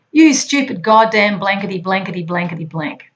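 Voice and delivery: mock tirade, loud start, fading through the censored curses; half-hearted imitation of a furious boss; retold angry rant. Angry boss berating the agent, a mock rant. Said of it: "He's screaming and circling me".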